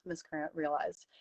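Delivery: ordinary delivery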